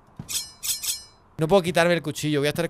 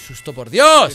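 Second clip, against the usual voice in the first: loud burst of fright at the scare; startled outburst; 14 dB louder, much slower than usual. Real, not acted. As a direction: blurt it out as the scare hits. Another sudden scare. (exclamación fuerte de susto)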